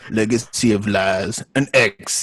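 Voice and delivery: booming trailer narrator voice; trailer voiceover. Pretend. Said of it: "I wanna try out for the DVD trailer voiceover".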